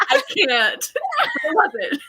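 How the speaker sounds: high, laughing squeal. Real, not acted. Friend laughs about the Elderlings reveal, high laughing squeal. Laughing after table-flip joke.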